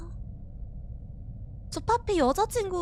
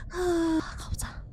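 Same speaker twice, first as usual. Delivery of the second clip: whisper; 9 dB quieter than usual. Real, not acted. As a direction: whisper as if not to be heard. Sneaking forward in horror game.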